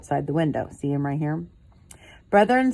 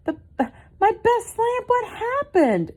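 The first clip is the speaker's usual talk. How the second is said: very high, slow dismayed voice; Mama asks her cubs, high and slow, what happened; upset mother; slower than usual. Acted. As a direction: draw it out in disbelief. Mama sees broken lamp.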